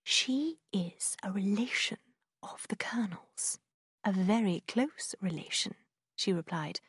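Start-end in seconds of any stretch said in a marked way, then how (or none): 0.0-5.7 secretive whispered gossip; old woman whispering. A narrator's reading. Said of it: "The old lady whispered to Eleanor".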